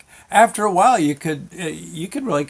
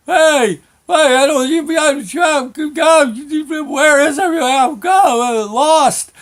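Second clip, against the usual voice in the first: high, loud wailing shouts; panicked hollering; 12 semitones higher, 6 dB louder than usual. Retold or imitated. Lost kid calling for father in fog, hollering. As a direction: holler like a lost, frantic child. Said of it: "And I start hollering".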